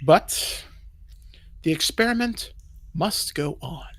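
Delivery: soft, halting talk to himself; resigned soliloquy. Acted. Elf alone complaining about fools, soft and resigned. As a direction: say it quietly to yourself, with pauses.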